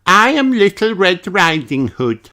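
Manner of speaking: put-on high little girl voice; proud child actor; Peppa's voice. A narrator's reading. Peppa introduces her part on stage, proudly. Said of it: "she says".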